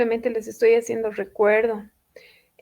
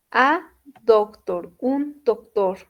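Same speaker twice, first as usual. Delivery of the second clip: "A doctor" said slowly, word by word; teacher models "A doctor" slowly; slow model read; slower than usual. Shown as a demonstration. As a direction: spell out "A doctor" for the class. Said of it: "model phrase again".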